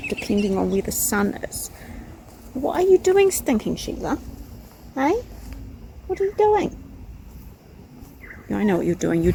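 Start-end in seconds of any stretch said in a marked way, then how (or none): 2.5-6.8 high, sing-out questioning of a pet; high-pitched chiding question to a cat; talking to pet. Real, not acted. Woman questions her cat Stinking Sheila, high pet-talk.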